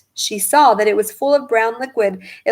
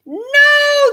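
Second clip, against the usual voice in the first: much higher, louder "No!"; horrified cry; 7 dB louder than usual. A narrator's reading. Parents seeing grandma about to drink, horrified cry. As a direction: scream to stop her drinking. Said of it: "the other two cried".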